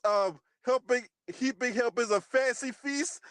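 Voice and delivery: ordinary delivery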